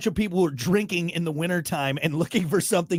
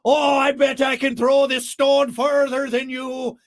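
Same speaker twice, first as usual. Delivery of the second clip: slow, loud, high Irish-leaning brogue; botched Scottish boast that comes out Irish; Irish-sounding Scotsman; 8 semitones higher, 5 dB louder, slower than usual. Acted. Drunk Scotsman challenging another to throw stones, in a stray Irish accent. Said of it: "That was more Irish".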